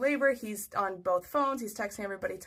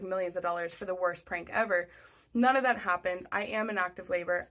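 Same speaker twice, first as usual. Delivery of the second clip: usual speaking voice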